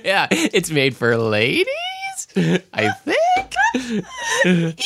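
laughing high and breaking up; cracking up mid-sentence. Real, not acted. Mocking ladies line, laughing.

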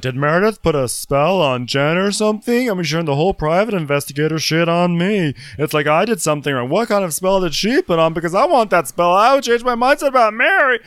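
high, loud, hammed-up whining rant; mock castmate's rant. Podcaster mimics Jenny's spiel about a spell, mock indignant.

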